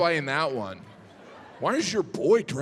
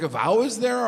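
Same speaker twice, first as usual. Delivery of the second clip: usual speaking voice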